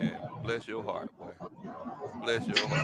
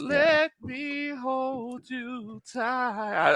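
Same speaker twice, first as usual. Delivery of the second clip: very slow, high crooned tease; teasing crooner, high and stretched; mock serenade; 8 semitones higher, 7 dB quieter, much slower than usual. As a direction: drag it out like a corny love song. Mocking what the boys said.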